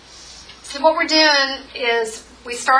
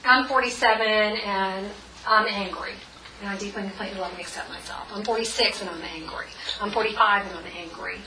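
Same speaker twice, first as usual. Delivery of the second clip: tapping phrase demo; 3 dB quieter than usual. Shown as a demonstration. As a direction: demo of a tapping script line. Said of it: "Like, um".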